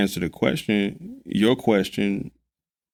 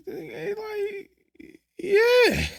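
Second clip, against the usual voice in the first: mock crying; much slower than usual. Mock sad admission.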